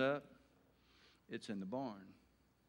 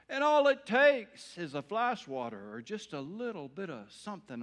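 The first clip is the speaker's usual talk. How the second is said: loud, high, emphatic sermon delivery; animated preaching; 6 semitones higher, 9 dB louder than usual. Acted. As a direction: drive it home to the congregation. Preacher on parenting, fervent.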